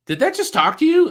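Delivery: high, loud, quick startled question; retold astonishment. Investigator to a passer-by at the scanner, astonished.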